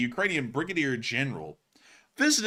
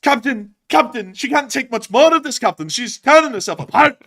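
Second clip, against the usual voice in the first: loud, higher Scotty panic; Scotty impression; 10 semitones higher, 10 dB louder than usual. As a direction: shout it as a frantic engineer.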